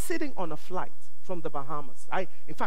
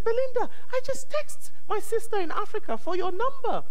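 squeaky retold self. Retelling her own words, high.

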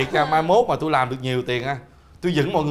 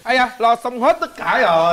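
raised, put-on dubbed-drama voice; film dubbing voice; 5 semitones higher than usual. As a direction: announce it like a dubbing actor. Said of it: "Nói giọng bình thường đi". (giọng lồng tiếng, lên cao)

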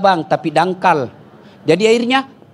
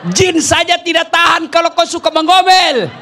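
preaching at full force; 10 semitones higher than usual. Acted. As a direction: drive the lesson home to the wives. (ceramah berapi-api dengan suara keras)

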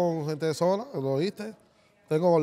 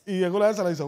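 ordinary talk, nothing marked

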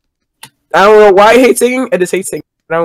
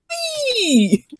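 high-pitched wee; 13 semitones higher, 8 dB quieter than usual. Retold or imitated. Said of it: "You just went, you just went flying, like".

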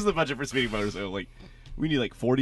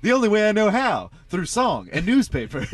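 heroic newsboy; 7 semitones higher, 4 dB louder than usual. Pretend. Voicing Chuck's character.

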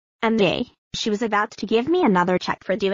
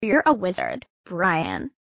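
usual speaking voice

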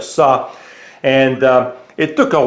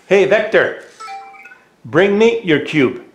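raised, higher-pitched command to a robot; raised and high, the way you call a pet across the room; 6 semitones higher than usual. Real, not acted. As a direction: call it out clearly so the robot hears.

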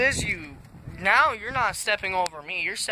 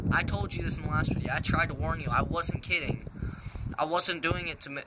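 usual speaking voice

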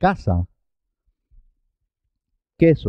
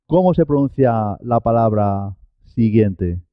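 ordinary delivery

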